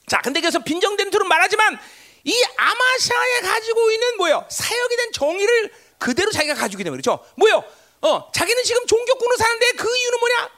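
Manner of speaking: high, loud pulpit delivery; forceful preaching. Real, not acted. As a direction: preach it with urgent, punched questions.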